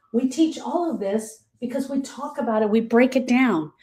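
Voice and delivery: usual speaking voice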